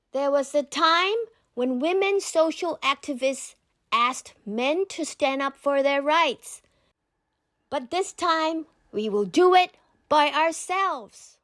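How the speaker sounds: rally the women in the room; rousing speech. A narrator's reading. Malala tells the UN women will stand up themselves, rousing.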